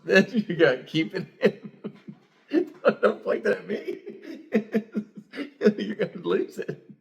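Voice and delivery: high, slow, laugh-filled teasing; can't stop laughing through the words. Real, not acted. Host teasing his wife not to lose it, laughing hard.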